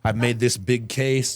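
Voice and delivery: slow, drawn-out mock excitement; slow ironic build-up. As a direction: savour each word, ironic glee.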